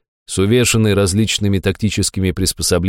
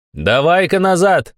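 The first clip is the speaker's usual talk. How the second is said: higher, slowed called-out command; direct order; 9 semitones higher, slower than usual. A narrator's reading. Narrator orders the runaway back, calling out. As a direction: call him firmly back. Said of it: "решил дать прямой приказ".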